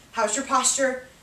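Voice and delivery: ordinary talk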